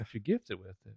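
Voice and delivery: put-on very soft Southern accent. Teasing a friend about accents, soft Southern accent. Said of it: "a Southern accent's a work of beauty".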